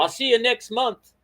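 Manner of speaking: a clumsy British impression of a friend; terrible British accent. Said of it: "that's a terrible British accent".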